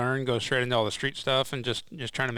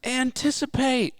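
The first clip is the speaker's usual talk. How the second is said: high, drawn-out sideline holler; mock cheering-parent shout, stretched out slowly; imitated mom's yell; 12 semitones higher, 4 dB louder, slower than usual. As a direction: yell it like a mom from the stands. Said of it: "She would always yell".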